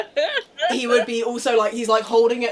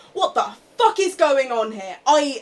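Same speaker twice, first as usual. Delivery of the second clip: enraged; 6 semitones higher, 8 dB louder than usual. Acted. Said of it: "incandescent with rage".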